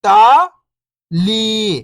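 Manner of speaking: first syllable lifting, pause, second falling. Shown as a demonstration. Teacher breaking a word down for beginning readers, syllable by syllable. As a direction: sound out each syllable alone, long and separated. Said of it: "एक अक्षर का उच्चारण करके".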